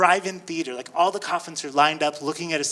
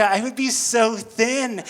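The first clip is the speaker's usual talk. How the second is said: usual speaking voice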